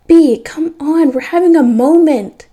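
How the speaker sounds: high, loud, drawn-out whine; slow, indignant shout to someone far off; exasperated protest. A narrator's reading. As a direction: call out in annoyance at being interrupted. Caught kissing, protests to father.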